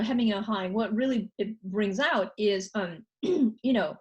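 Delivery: usual speaking voice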